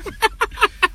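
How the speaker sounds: high giggling burst; high-pitched laugh. Real, not acted. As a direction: crack up after your own joke. Laughing at own joke.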